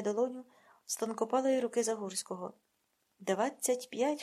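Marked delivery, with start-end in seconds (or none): 3.2-4.2 very slow, grudging; reluctant haggling. Acted. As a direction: drag out the price grudgingly. Rabbi haggling, drawn out.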